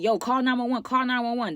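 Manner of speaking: retold urgent plea. Telling friends at the locked door, acted urgency. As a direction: act out pleading with friends to get help.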